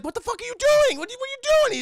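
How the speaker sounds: high, loud, alarmed shout; Sonny screaming at his partner over the killing; shocked yelling. Retold or imitated. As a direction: yell it in disbelief, way up high. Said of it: "Sonny's like".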